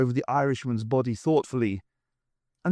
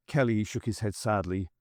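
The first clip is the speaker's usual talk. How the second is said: plain reading voice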